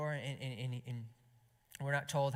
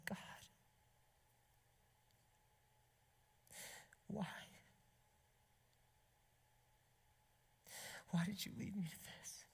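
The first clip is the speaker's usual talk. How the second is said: hushed, high, pained plea; acted anguish, soft with long broken pauses; tearful prayer; 7 semitones higher, 9 dB quieter than usual. Betrayed husband questioning God, in tearful hushed prayer. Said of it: "tears start rolling down his cheeks".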